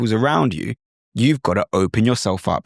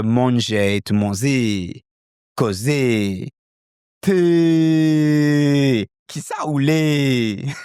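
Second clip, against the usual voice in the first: Reunion Creole phrases with a shifted local accent; 3 semitones higher, much slower than usual. Shown as a demonstration. Teacher demoing Reunion Creole accent. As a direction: speak it the way the Reunion Creole speakers do. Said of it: "allowed me to get the vous to vous".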